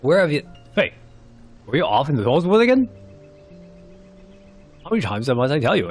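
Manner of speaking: scolding. Acted. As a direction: tell off a wayward child, exasperated.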